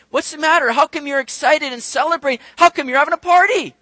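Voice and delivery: high, loud, whining protest; whiny complaining Pharisees. Acted. Pharisees complaining to the feasting disciples, high and whiny. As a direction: whine like hungry sulking Pharisees. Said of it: "where they're saying".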